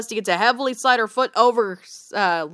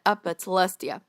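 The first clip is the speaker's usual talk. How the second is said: ordinary delivery, nothing marked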